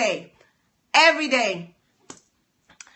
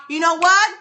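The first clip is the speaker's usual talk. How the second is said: ordinary talk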